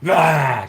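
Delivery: a loud barking rah; mock angry roar; sudden roar. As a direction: imitate him snapping with a roar. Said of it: "angle, like".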